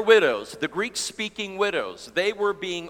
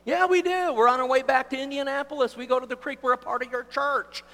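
put-on high, excited young couple; eager insistence; 9 semitones higher, faster than usual. Couple insisting in acted exchange.

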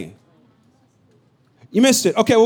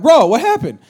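raised, higher puzzled question; baffled dad; 5 semitones higher, 5 dB louder than usual. Retold or imitated. Dad puzzled by son running the ball, raised. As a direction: ask in loud disbelief. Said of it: "I'm like".

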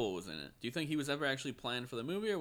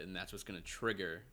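ordinary talk

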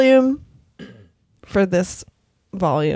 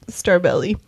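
ordinary talk, nothing marked

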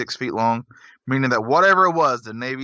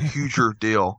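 mocking repeat; 3 semitones lower, slower than usual. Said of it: "That's just how Carter talks".

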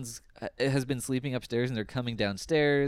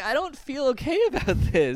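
much higher, hammed-up weepy voice with a laugh; mock teary whine; 13 semitones higher, 4 dB louder than usual. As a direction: whimper it like you're fake-crying. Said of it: "I had tears in my eyes".

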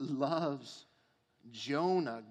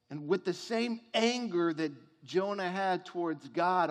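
ordinary delivery

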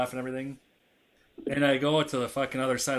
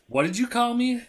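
high-pitched, incredulous dad demand; retold indignation; 11 semitones higher than usual. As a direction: re-enact an angry parent's sharp question. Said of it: "I was pissed".

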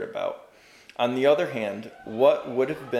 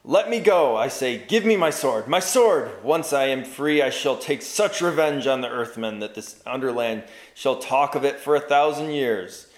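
loud, higher frantic cry; rising shriek; 3 semitones higher, 4 dB louder than usual. Acted. Frenzied prisoner demanding his sword, shrieking. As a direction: rage against the bonds. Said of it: "voice was now rising into a shriek".

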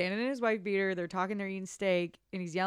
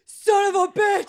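high, loud, slow bellow; yell; 11 semitones higher, 14 dB louder than usual. Retold or imitated. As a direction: roar it out with passion.

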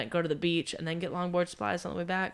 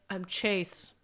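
deadpan, slow name reveal; mock serious introduction; 4 semitones lower, much slower than usual. Storyteller imitates stranger introducing himself, mock serious. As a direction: imitate him introducing himself in dead earnest. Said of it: "so serious".

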